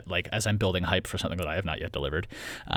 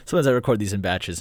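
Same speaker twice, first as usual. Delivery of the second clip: normal talking voice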